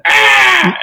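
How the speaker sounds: very high, loud mock shriek; predator screech. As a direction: shriek it like a startled creature. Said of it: "he does, like, the".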